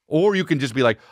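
ordinary talk

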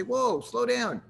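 high interrupting whoa; whoa, slow down. Retold or imitated. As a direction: rein in an eager pitcher. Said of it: "and I'll say".

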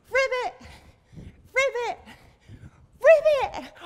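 high, loud croak-word on a jump; frog ribbit. Pretend. Teacher hops with children as a frog, loud high ribbit. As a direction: leap and burst it out like a frog. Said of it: "jump up and say ribbit".

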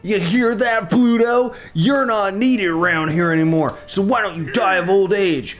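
high, slow, swaggering bully voice; drawn-out, high-pitched sneering big shot; arrogant boss character. Acted. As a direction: lord it over the old boss and his lackeys.

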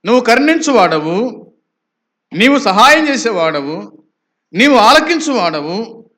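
high, loud praise in repeated surges; fervent proclamation. Acted. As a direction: cry it out to God like the psalmist. Preacher voices psalmist addressing God.

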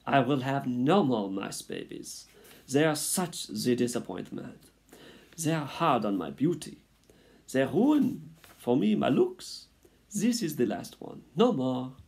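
high, loud, melodramatic French mother mouse; Antoinette. A narrator's reading. Mother mouse swears off babies, proclaiming in French accent. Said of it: "proclaimed Antoinette from her bed".

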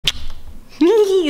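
high, loud burst of laughter; a real nervous giggle; embarrassed laugh. Real, not acted. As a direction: laugh it off, caught out on camera. Embarrassed filming on the flight.

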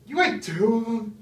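high, loud shocked question; stunned, voice shooting up; shocked disbelief. Real, not acted. Shocked he ate two peppers.

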